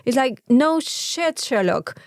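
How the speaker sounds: ordinary talk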